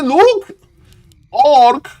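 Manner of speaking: sudden high, loud cry of excitement; excited outburst. Real, not acted. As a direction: burst out as the play unfolds. Caster sees a move mid-fight.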